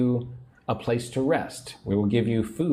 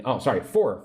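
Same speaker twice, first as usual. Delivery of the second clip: ordinary talk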